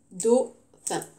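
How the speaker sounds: "Dauphin" in two separate beats, dau-phin; split into syllables. Shown as a demonstration. Teacher counting syllables for the class, "Dauphin" syllable by syllable. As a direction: break "Dauphin" apart with a gap between syllables. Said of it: "Combien de syllabes pour dauphin?".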